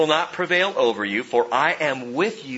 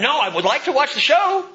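higher, louder, snippy imitation of himself; mock grumpy reply; 14 semitones higher, 6 dB louder than usual. Pastor imitating his grumpy reply to his wife, snippy mock. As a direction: ham up a tired husband's brush-off. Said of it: "Can you imagine living with me?".

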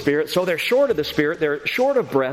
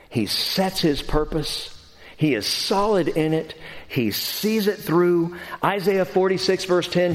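ordinary talk, nothing marked

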